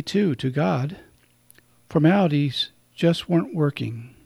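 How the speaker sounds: measured, unhurried poem reading with pauses. Acted. Priest reads a short poem to radio listeners, measured. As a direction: read the poem out gently for listeners.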